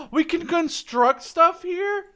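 gasp, then high, loud and slow; thrilled discovery with a sharp gasp; amazed gasp. Real, not acted. Gamer discovering he can build in his town, amazed gasp.

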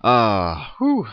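hiccuping breath; hiccups. Real, not acted. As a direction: hiccups breaking the words. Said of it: "now that I have the hiccups".

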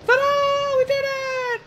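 high, bright ta-da of victory; triumphant cheer. Real, not acted. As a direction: crow the win way up high. Triumph after climbing the stairs.

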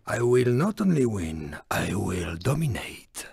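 low, hushed villainous vow; sinister private boast; quiet menace. Acted. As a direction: drop right down, soft and menacing. Villain vows to win.

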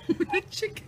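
high squeal at the wriggling fish; surprised squeal. Real, not acted. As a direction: yelp as it slips.

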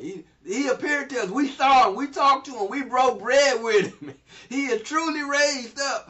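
overjoyed disciples. Acted. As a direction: shout the good news, overflowing with joy. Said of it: "And they just couldn't keep it".

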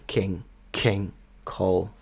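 soft king, king, call with a puff of air after k; English king, call, airy k; light aspiration on K. Shown as a demonstration. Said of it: "Бачите, легенький придих".

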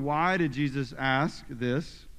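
usual speaking voice

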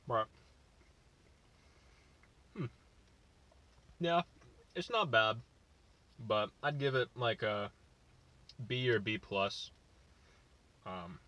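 talking between bites; chewing while talking. Real, not acted. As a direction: mouth partly full. Seen 0: Bites taco at -2; lips pursed, jaw working while talking.

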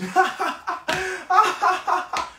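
high, loud imitated laugh; driver's laugh. Imitating the laughing Uber driver, high mock laugh. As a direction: mimic the driver's unsettling laugh. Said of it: "Il rigole et dit".

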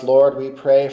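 usual speaking voice

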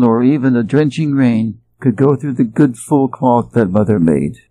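usual speaking voice

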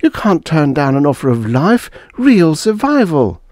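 high plea, slowing at the end; plead, climbing high on the last words. A narrator's reading. Wife argues for survival on the moon, pleading. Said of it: "pleaded Beth".

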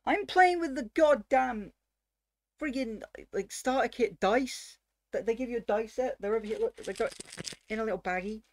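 high, loud exasperated rant. Real, not acted. Venting to viewers about the starter kit dice, exasperated. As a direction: protest in disbelief, voice pushed up high.

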